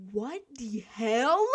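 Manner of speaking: slow, drawn-out baffled question; stunned what the hell. Retold or imitated. Coworker sees the manager deflate balls, slow stunned disbelief. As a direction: disbelief stretched long. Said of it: "I said".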